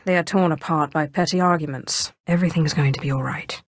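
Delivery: ordinary delivery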